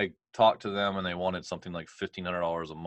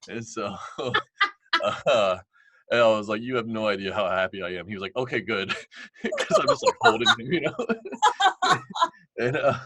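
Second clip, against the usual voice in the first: breaking into high laughter mid-sentence; laughing hard; 4 semitones higher, 8 dB louder than usual. Real, not acted. Recalling hugging his tiny accountant, helpless laughter. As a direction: laugh through the words, pitched up and louder.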